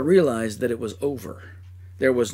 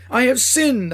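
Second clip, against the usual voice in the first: higher, louder anguished confession; weeping cry; 11 semitones higher, 6 dB louder than usual. Acted. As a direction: cry it out in remorse. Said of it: "I cried as I wept aloud".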